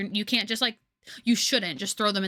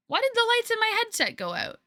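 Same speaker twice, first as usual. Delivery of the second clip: surprised; 12 semitones higher than usual. Real, not acted. Sudden headset lights going out.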